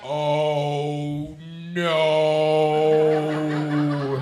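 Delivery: loud, long drawn-out tranquilized moan; Will Ferrell. Retold or imitated. Comic yells back like a man hit by a dart, drugged slow yell. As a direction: stretch each word, low and loud, as if drugged. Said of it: "moment of Will Ferrell getting the tranquilizer dart".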